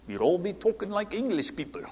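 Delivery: hammed-up English accent, softly delivered; dubious English accent. Preacher joking to congregation about the founders, dubious English accent. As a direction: have a shaky-at-best go at sounding English. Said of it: "I don't even know if that's an English accent".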